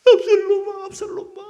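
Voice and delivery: 아들을 부르며 터지는 울음, 끝에서 목소리가 높이 솟음; 잃은 아들을 부르며 흐느끼듯; 울부짖는 아버지. Acted. 다윗의 울부짖음을 재연. (아들을 잃고 울부짖는 아버지의 목소리)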